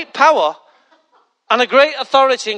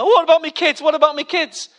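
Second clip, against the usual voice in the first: sudden higher-pitched mock panic; 4 semitones higher than usual. Passenger thinking of the kids, mock panic. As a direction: jump up in pitch, fretting.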